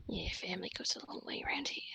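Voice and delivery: knowing conspiratorial whisper; hushed informant whisper. Acted. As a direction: whisper it with a wink.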